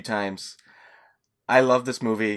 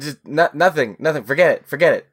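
nervous; 5 semitones higher, 7 dB louder, faster than usual. Acted. Podcaster caught, panicking.

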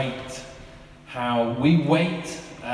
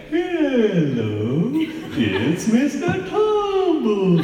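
high, slow, sing-song clown voice, stretched out; Mister Tumble clown voice; 10 semitones higher, much slower than usual. Retold or imitated. Dad imitating his son's clown impression. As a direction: imitate a kid copying a TV clown. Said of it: "a bit of a strange sort of clown voice".

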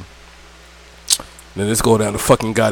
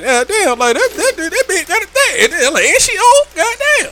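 flustered disbelief. Retold or imitated. Flustered inner reaction retold.

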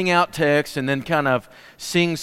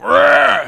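loud mock growl, pitched up; imitated kid growl; 10 semitones higher, 7 dB louder than usual. Pastor imitating a kid's scary growl to the congregation. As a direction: growl like a kid trying to scare. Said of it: "He went".

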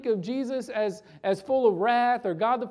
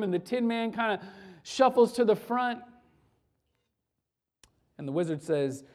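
ordinary talk, nothing marked

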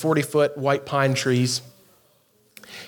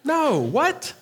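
baffled; 9 semitones higher than usual. Retold or imitated. Baffled reply to the emails.

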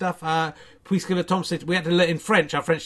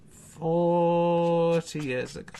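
slow echo; much slower than usual. Pretend. Drawn-out echo.

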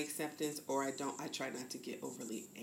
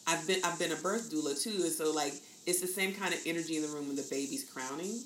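ordinary delivery

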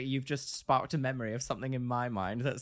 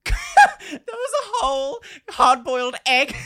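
high, loud shout through laughter; slow, incredulous reveal, cracking up; laughing; 11 semitones higher, 11 dB louder, slower than usual. Real, not acted. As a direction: shriek the punchline while laughing. Laughing at the story's punchline.

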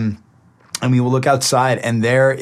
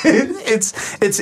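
laughing; 10 semitones higher than usual. Retold or imitated. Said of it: "my mom just goes".

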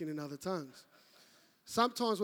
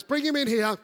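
loud, higher-pitched imitation of wife; exasperated order; 5 semitones higher, 10 dB louder than usual. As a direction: call it out as a fed-up wife. Exasperated wife orders him inside.

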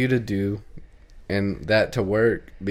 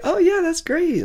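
much higher, mock-bright polite voice; fake enthusiasm; 3 dB louder than usual. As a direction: squeak it out like a fake-nice girl. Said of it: "And she's like".

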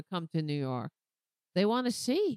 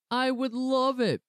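mock taunting Trump voice; 5 semitones higher, 4 dB louder than usual. Imitating Trump taunting the judge. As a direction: sneer it like Trump goading the judge. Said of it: "Trump responded, taunting the judge".

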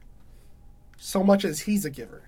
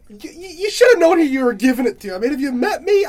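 heated; 6 semitones higher, 7 dB louder than usual. Acted. Brothers' heated argument.

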